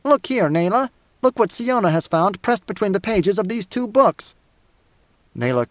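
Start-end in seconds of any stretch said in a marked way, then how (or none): 0.0-4.4 high-pitched, squeaky fat man. A narrator's reading. Topri showing Nayla what Siona found, squeaky. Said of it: "Topri squeaked when he spoke".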